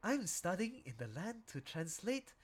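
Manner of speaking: a polite seal introducing itself; lightly voiced seal. Voicing seal character intro.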